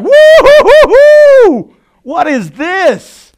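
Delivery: long, high, very loud whooping; re-enacted ecstatic new-believer whoop; lit up. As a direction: whoop it out in amazed elation, drawing out each word. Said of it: "I was lit up".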